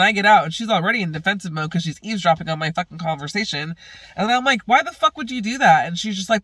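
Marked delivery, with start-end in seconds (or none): 4.6-5.9 high, loud accusing question; angry confrontation. Retold or imitated. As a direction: demand an answer, outraged. Retells confronting Stella.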